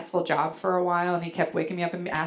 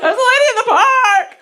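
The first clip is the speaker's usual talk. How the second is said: high, very loud panicked scream; terrified yell; 15 dB louder than usual. Retold or imitated. Husband yelling in his sleep, hammed-up terror. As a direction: scream it in mock nightmare terror. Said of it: "Just so terrified sounding".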